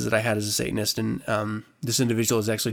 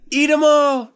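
gleeful offer; 9 semitones higher, 5 dB louder than usual. Real, not acted. Joking about the candy.

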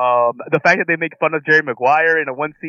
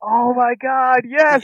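excited; 11 semitones higher, slower than usual. Real, not acted. Excited recognition.

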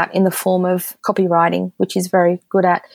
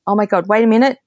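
ordinary talk

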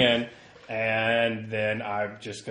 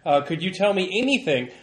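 raised, higher voice pressing for answers; insistent reporter; 4 semitones higher, 6 dB louder than usual. Acted. Reporter questioning a reluctant worker, insistent and raised.

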